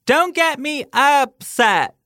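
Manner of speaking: high, slow, loud ghostly warning. Retold or imitated. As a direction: intone it like a menacing ghost.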